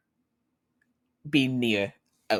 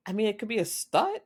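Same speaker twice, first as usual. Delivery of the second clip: sarcastic retort; 11 semitones higher, faster than usual. Acted. Son answering his dad sarcastically.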